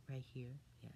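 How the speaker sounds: a hushed, breathy aside to the listener; whispered aside. A narrator's reading. Narrator whispers to the child, pointing at the picture.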